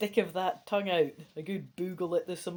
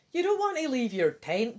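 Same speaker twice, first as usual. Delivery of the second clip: ordinary delivery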